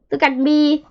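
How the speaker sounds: put-on high, loud young beetle; eager showing off. A narrator's reading. Young click beetle shows off after a tumble, eager. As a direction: call out proudly for everyone to watch.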